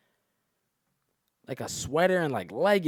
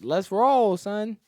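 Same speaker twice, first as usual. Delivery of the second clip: hyped-up invitation; 10 semitones higher, 9 dB louder, much slower than usual. Retold or imitated. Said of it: "I'm like".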